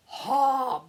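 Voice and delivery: loud, sudden ogre bark "Ha!"; startling monster shout; ogre roar "Ha!". Acted. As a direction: jump out with a big ogre "Ha!". Ogre bursts out.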